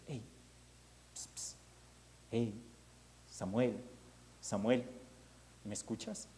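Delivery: soft hushed call, psst and name; mysterious calling voice. Acted. Mysterious voice softly calling the sleeping Samuel. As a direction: coax the sleeping boy awake, softly. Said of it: "escucha una voz que le dice".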